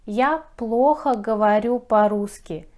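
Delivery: ordinary delivery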